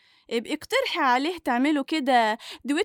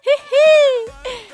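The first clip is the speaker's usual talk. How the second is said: loud high teasing laugh; teasing cackle; 11 semitones higher, 9 dB louder than usual. Acted. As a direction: cackle at her grandmother.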